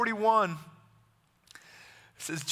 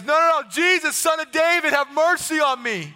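loud, high cry for mercy; insistent beggar's yell; yelling over the crowd; 8 semitones higher, 7 dB louder than usual. Acted. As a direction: shout past those silencing you. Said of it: "the more he yelled".

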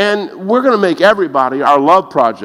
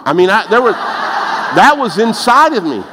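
ordinary talk, nothing marked

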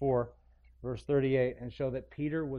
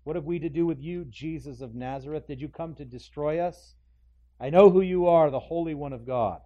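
demon crying out; 3 semitones higher, 9 dB louder than usual. Acted. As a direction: shriek it as the demon. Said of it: "cried out with a loud voice".

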